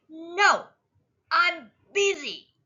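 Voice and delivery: high, loud brush-off; stubborn refusal. A narrator's reading. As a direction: push them away like a busy little kid. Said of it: "But Sonny says".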